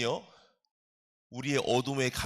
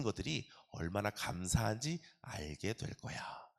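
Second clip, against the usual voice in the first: tender voice of the Lord; 6 semitones lower, 7 dB quieter than usual. Acted. God speaking tenderly to soul.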